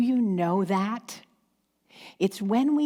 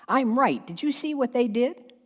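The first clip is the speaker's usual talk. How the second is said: higher-pitched, accusing mock outrage; self-righteous indignation; 6 semitones higher than usual. Judgmental person pointing out another's fault, mock-indignant. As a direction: play up the righteous finger-pointing. Acts out someone judging others.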